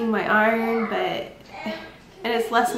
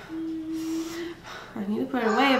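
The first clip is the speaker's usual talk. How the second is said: weary self-talk; 6 semitones higher, 5 dB quieter, slower than usual. Retold or imitated. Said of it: "I'm like".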